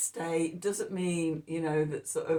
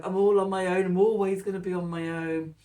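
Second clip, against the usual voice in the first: louder, mock self-pitying whine of the ego; complaining; 4 dB louder, faster than usual. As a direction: complain as the doubting ego, a touch overdone. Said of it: "um, complaints".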